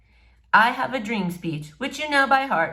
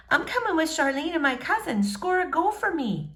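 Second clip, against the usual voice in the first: put-on higher, light girl's voice; 8 semitones higher than usual. A narrator's reading. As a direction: cheer him on as the girl. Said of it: "she says".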